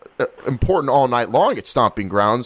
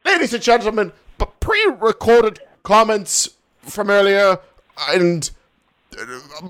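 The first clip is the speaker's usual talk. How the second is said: mock British sign-off; 5 semitones higher than usual. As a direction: wrap up the segment like a posh host. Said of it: "correspondent signs off in character".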